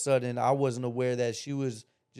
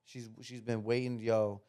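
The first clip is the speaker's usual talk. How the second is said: ordinary delivery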